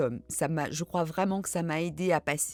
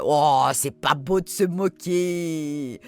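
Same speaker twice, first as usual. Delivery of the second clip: slow, drawn-out playful reproach; mock scolding; 3 semitones lower, 6 dB louder, much slower than usual. Teased by a fan, she scolds back in slow mock reproach. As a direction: wag a finger at the teasing fan, slowly. Teased about her accents.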